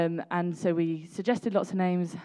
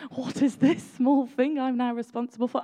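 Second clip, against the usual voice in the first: voicing the baffled new father, higher and a bit louder; a dad staring at the baby, stunned; bewildered dad; 6 semitones higher, 5 dB louder than usual. Mother voicing dad's face in the baby photo, mock bewildered. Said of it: "Look at my dad's face".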